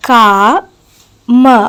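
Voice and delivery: "ക, മ" as two separate syllables with a pause between; split into syllables. Shown as a demonstration. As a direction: say "ക", pause, then "മ". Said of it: "രണ്ട് syllables ഉണ്ട്".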